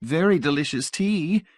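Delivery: high, bright-pitched Mrs Pritchett voice; old lady gushing over the tea; exclaiming. A narrator's reading.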